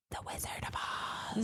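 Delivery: hushed dramatic reveal; dramatic whisper. Real, not acted.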